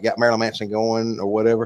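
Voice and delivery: usual speaking voice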